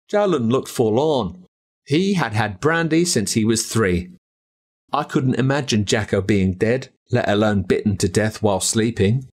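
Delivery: ordinary delivery, nothing marked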